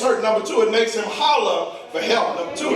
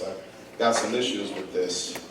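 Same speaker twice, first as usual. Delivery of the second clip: ordinary delivery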